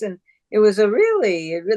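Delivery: ordinary talk, nothing marked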